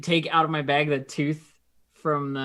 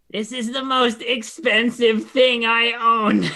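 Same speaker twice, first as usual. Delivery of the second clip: laughing kobold; 8 semitones higher, 8 dB louder, slower than usual. Acted. Kobold parts with treasure, laughing.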